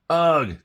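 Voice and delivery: loud, high ugh of disgust; disgusted groan. Acted. Jim reacting to Marabou's singing, loud disgusted ugh. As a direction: groan like the song hurts your ears.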